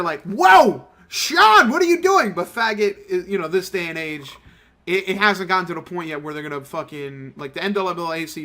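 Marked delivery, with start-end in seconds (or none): 0.2-2.3 high, loud shocked outburst. Retold or imitated. Studio colleagues stopping a voice actor, shocked. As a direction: yell it in mock alarm.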